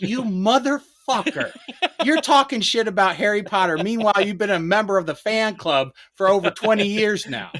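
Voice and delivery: high, laughing rant at a friend; laughing mock insult. Teasing his friend Sean, a laughing mock rant. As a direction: rib him through laughter. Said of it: "I was like".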